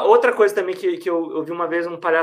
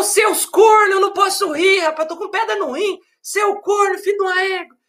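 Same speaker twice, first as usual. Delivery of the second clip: laughing cursing patient; 12 semitones higher than usual. Retold or imitated. Patient laughing at the clowns despite kidney stones, high laughing yell. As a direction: curse at them while laughing in pain. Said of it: "Aí ele falou".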